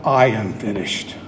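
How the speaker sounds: dropped very low, well under the preaching voice; not cried out. Acted. Preacher voicing what Jesus doesn't say, very low-pitched. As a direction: sink the pitch right down on "I am finished". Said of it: "He does not cry out".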